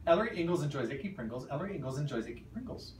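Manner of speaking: quick timed run; fast. Shown as a demonstration. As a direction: rattle it off fast. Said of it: "I will also do [name]'s two times".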